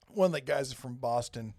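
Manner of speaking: ordinary talk, nothing marked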